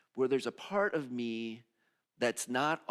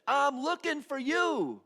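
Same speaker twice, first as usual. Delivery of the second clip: master's invitation; 12 semitones higher, 5 dB louder than usual. Acted. Master calling the messy ones in, high and loud. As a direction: call them in with big joy. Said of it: "the master says".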